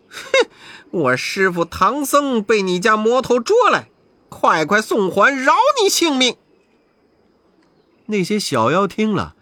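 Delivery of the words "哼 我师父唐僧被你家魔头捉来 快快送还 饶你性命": threatening. Acted. Monkey King threatening the little demons. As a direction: demand the master back or else. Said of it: "悟空说".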